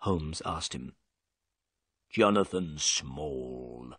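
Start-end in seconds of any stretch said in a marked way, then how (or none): 2.1-3.9 low, slow prisoner's answer; gruff prisoner. Acted. Prisoner to Holmes, giving his name slowly and low.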